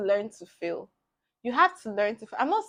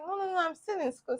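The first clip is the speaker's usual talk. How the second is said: small, higher, soft voice; sheepish acted reply; 7 semitones higher, 7 dB quieter than usual. Student answering church people, sheepish small voice.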